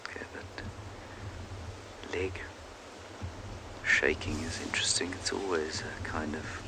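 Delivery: soft, half-whispered wildlife commentary; hushed commentary. Real, not acted. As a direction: keep it hushed near the animal. Watching the elephant at close range.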